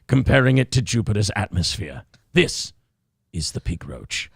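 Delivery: low, hushed wildlife documentary narrator; nature documentary narration. Acted. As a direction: narrate like a nature film, deep and measured. Nature documentary parody continues.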